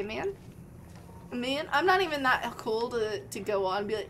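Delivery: usual speaking voice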